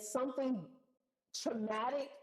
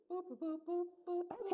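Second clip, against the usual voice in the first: phone beeps; 8 semitones higher, 4 dB quieter than usual. Retold or imitated. Imitating phone hang-up tone.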